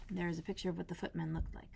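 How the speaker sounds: soft unvoiced whisper; whispered aside. Real, not acted. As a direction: whisper it to the listener. Reader aside in hushed voice.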